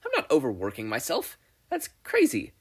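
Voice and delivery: forced denial. A narrator's reading. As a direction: laugh it off, unconvincingly. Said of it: "let out a forced chuckle".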